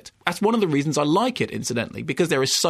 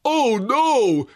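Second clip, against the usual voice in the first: mock Nicolas Cage; 8 semitones higher, 4 dB louder than usual. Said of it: "the horse-faced Nicolas Cage going".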